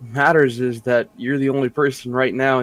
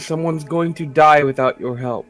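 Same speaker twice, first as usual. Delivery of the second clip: raised, pressing cry for help; urgent plea; 5 semitones higher, 7 dB louder than usual. Acted. Player begs cleric to heal someone dying, urgent plea. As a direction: plead loudly for a dying companion.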